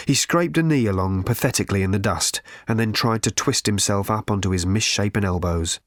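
usual speaking voice

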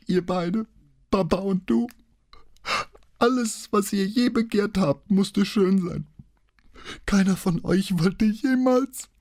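very high tearful wail; crying. A narrator's reading. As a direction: accuse them both through streaming tears, voice climbing. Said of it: "Während ihr die Tränen unaufhaltsam aus den Augen strömten". (schluchzend, mit hoher, verweinter Stimme)

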